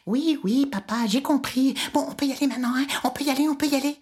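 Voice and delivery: put-on high, bouncing child's hurry; a kid who can't wait to go; impatient eager boy. Icare eager to fly.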